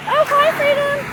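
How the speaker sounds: high, cooing pet voice. Real, not acted. Owner greets her parrot.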